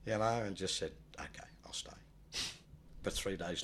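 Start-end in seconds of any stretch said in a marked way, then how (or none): 1.2-1.9 quietly broken down. Retold or imitated. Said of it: "broke down a little bit".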